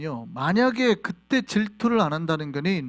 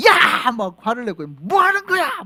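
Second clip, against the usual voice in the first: loud, high shout of 야 and 뭐 하는 거야; God scolding angrily; angry divine rebuke; 6 semitones higher, 7 dB louder, slower than usual. Acted. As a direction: yell like an outraged God. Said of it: "뭐 이러고 있는 거는".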